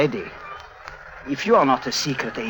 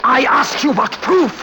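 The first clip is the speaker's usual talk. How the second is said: high, loud and slow, each word pressed; slow furious demand; 10 semitones higher, 4 dB louder, slower than usual. Acted. Clown throttling Harry, slow furious demand. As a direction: demand an answer through clenched rage.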